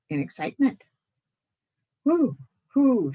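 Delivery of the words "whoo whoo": high, loud whooing; owl hoots. Acted. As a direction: hoot like an excited owl. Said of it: "owl hoots".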